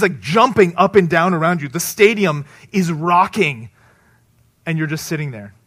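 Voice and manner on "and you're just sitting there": usual speaking voice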